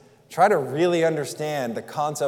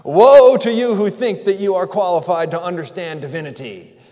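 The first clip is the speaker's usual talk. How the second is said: thundering God; 4 semitones higher, 10 dB louder than usual. Acted. Preacher voicing God rebuking his people, loud and booming. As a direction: boom it out like an angry God.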